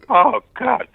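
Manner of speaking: a high, sudden cry of dismay; stunned, high-pitched exclamation; dismayed oh god. Retold or imitated. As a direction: let it jump up in disbelief. Dismay at the blind mastering engineer.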